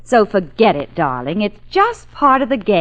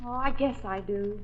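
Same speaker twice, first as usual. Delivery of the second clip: much softer, almost shy; soft, tender admission; 4 semitones lower, 9 dB quieter than usual. Acted. Claire admitting to her boss she'd miss him, tender. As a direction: let it slip out gently.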